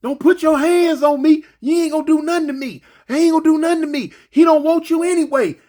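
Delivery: high, fast hammed-up yelling; imitating an inmate hollering back; mock hollering. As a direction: shout back in a panic, fast. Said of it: "Blowfish hooping and hollering".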